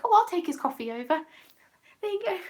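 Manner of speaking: high-pitched, smitten eagerness; flustered. Retold or imitated. Smitten worker offering to take his coffee over, flustered. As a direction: retell it in a high, fluttery voice. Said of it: "Got a little bit flustered".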